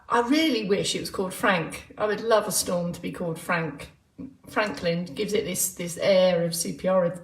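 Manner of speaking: ordinary talk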